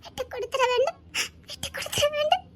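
breaking into a tearful cry; tearful plea. Acted. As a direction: plead through tears.

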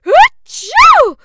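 huge high achoo; explosive sneeze; loud sneeze. A narrator's reading. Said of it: "She sneezed again".

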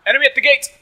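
high, loud battle warning; urgent yell to the team; shouted alarm. Acted. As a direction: call it out like a lookout. Calls out an enemy in game.

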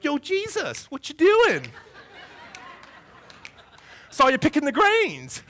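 high, loud street-gang challenge with a laugh; West Side Story gang tough. Retold or imitated. Pharisees ambushing Jesus in the grain field, gang-tough voice. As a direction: jump out like a Jets gangster calling him out. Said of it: "this is how I picture it".

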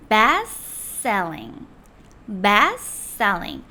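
"Best selling" with the t of best dropped: "베스셀링". Shown as a demonstration. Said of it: "트를 발음하지 않으시는 거예요". ("Best selling" 트 빼고 베스셀링)

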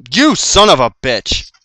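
loud, high, drawn-out swearing; angry yell. Real, not acted. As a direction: curse slowly and loudly at the enemy. Cursing an enemy in game.

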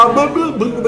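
a speechless mock stutter; stammering sputter. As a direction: imitate them stuck for words. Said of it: "έτσι κάνουνε".

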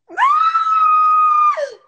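high, loud shrieking laugh; shrieked laugh; loud burst of laughter. Real, not acted. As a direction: crack up loudly at the name. Laughs at naming him Trouble.

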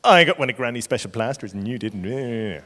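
put-on sing-song childish taunt; mock kiddie one-upmanship, last word dragged out; boasting little brother. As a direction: gloat at a sister like a small boy. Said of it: "probably to his sister, and go".

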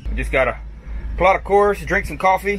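ordinary delivery

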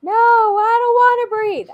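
loud, high-pitched imitation of a refusing child; mock child's protest. Overwhelmed child refusing the teacher, mock loud protest. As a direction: act out an overwhelmed kid's yelled refusal.

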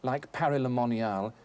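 ordinary talk

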